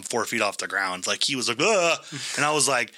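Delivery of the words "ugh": short startled grunt, pitched up; mock gasp of panic; imitated startled yelp. Father-in-law grabbed by the hand, imitated startled yelp.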